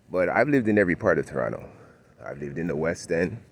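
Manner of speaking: ordinary delivery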